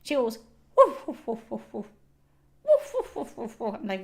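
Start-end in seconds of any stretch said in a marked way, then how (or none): 0.8-1.9 muffled run of mock barks; sleep barking. Owner imitating her dog barking in her sleep, woofing. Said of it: "barks in her sleep". 2.6-3.8 mock dream barks tailing away; sleep barking. Owner showing how her dog barks while asleep, woofing. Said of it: "barks in her sleep".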